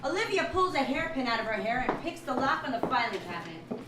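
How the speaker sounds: usual speaking voice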